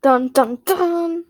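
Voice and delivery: mock-ominous sung "dun, dun, dun"; dramatic sound effect. Shown as a demonstration. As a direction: hum a playful dramatic sting. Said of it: "That's my dramatic sound effects".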